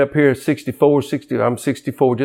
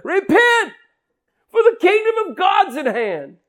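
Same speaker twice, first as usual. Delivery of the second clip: high commanding town crier call; John the Baptist crying out. Retold or imitated. Preacher voicing a prophet in the wilderness, high commanding call. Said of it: "a real commanding voice".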